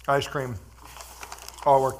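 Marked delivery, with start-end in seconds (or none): none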